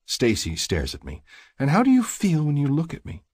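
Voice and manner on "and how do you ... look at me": high-pitched woman's voice; high, pressing female question; challenging woman. A narrator's reading. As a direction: put the question to him in a high voice. She challenges his declaration.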